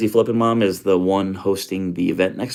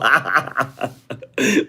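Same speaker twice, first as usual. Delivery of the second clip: amused laugh; 5 semitones higher than usual. Real, not acted. Laughs at the question.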